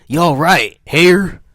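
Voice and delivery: high, loud drawn-out holler; bawling. A narrator's reading. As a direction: bawl it after them in a put-on accent. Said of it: "bawled after us".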